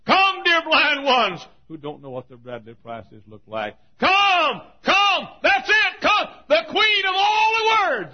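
high, shouted altar call; full-force preaching. Real, not acted. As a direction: cry out the invitation to the crowd.

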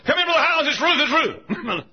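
high, loud plea, slowing on Ruth; desperate, pulling the preacher inside; frantic husband. Retold or imitated. Husband at door to preacher: frantic, high, loud.

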